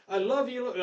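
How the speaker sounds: loud, high pleading preacher cry to God; imitating Robert Duvall. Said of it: "I'm trying to imitate him".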